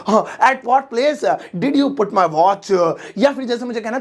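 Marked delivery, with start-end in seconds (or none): 0.4-2.9 slow, proud English; stretched out; slow repeat. Shown as a demonstration. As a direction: drill it for the class. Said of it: "ओह, मुझे English आ गई".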